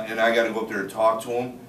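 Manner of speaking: ordinary talk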